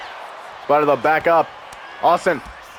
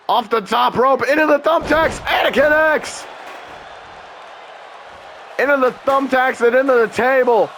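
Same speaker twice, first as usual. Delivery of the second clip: excited shout; 7 semitones higher than usual. Real, not acted. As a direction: yell as the move connects. High move connects.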